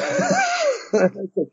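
squealing high-pitched laugh; giddy high laugh of disbelief. Real, not acted. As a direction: let out a shocked squeal of laughter. Laugh after the shock.

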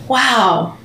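ordinary talk, nothing marked

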